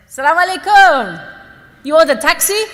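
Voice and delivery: high, loud friendly greeting; cheerful driver greeting. Retold or imitated. Taxi driver greeting stranded woman, loud and cheery. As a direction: call out brightly from the car.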